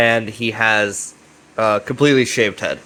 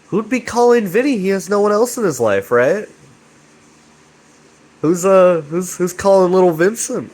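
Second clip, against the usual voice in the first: intrigued surprise; 7 semitones higher than usual. Real, not acted. Narrator wondering aloud who calls Vinny, surprised. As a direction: play up the mystery of who's calling. Dramatic mystery about the caller.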